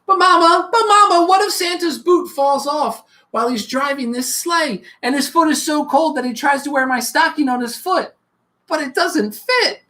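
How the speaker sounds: put-on eager little child calling Mama; a child pestering with questions; small child full of what-ifs; curious child.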